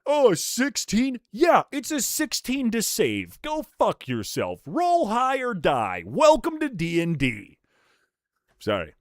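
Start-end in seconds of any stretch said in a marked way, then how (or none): 0.0-7.6 taunting DM. Pretend. Mock taunting DM to players.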